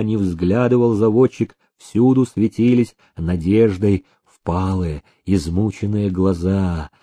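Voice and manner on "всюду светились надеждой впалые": plain reading voice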